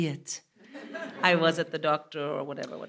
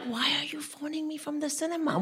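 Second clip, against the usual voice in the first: high, urgent stage whisper; hushed but indignant; re-enacted whisper; 7 semitones higher, 5 dB quieter than usual. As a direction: whisper the question down the phone. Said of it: "Why am I still whispering".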